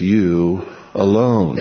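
You alone said slowly, with alone leaned on hard; stress on alone, slow. Shown as a demonstration. Preacher stressing the Greek to his congregation, slow emphatic alone. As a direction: drag out You alone and press on alone. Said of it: "emphatic in the Greek".

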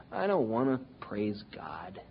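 whispered, sullen mutter; sulky grumble. Retold or imitated. Preacher voicing a bitter believer who won't praise, whispered. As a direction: breathe it out like a sulking believer.

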